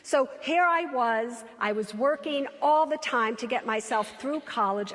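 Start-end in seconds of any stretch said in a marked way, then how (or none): none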